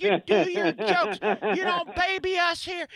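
loud, slow, high mock old-lady voice; hundred-year-old lady. Old lady orders the comic to do his jokes, loud high mock scolding. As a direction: imitate an old heckler scolding, drawn out and high. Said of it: "this lady in the front had to be a hundred".

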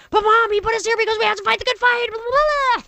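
high, fast, worked-up self-quote; mock-exasperated daughter pleading with mom; animated pleading. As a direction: rattle it off in a high excited rush. Herself quoted arguing with mom.

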